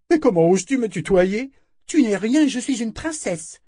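high, loud, haughty rebuke; offended royal brat; outraged princess. Acted. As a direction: pull rank in a high, indignant voice. Outraged princess rebukes her servant.

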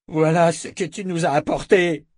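high, loud accusing cry; angry shout. A narrator's reading. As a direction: shout the blame at him. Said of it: "cria le guerrier à la lance".

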